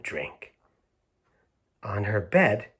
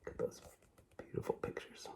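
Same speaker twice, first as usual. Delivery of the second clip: whisper; 19 dB quieter, faster than usual. Real, not acted. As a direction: breathe it softly, full whisper. Whispering over picture book.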